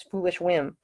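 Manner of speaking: ordinary delivery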